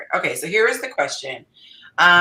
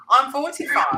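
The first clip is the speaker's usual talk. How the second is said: higher, louder put-on British accent; 6 semitones higher, 6 dB louder than usual. Shown as a demonstration. Host reading the submitted question for fun, in a British accent. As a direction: open in a playful British accent. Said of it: "Can I do this in a British accent?".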